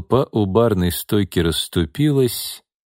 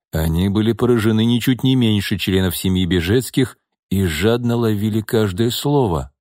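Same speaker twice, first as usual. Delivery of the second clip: ordinary delivery, nothing marked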